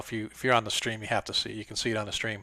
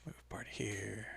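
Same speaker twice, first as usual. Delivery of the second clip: whispered mutter; 2 semitones lower, 18 dB quieter, much slower than usual. Real, not acted. Muttering while moving tokens.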